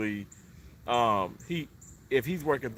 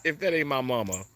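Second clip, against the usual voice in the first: ordinary talk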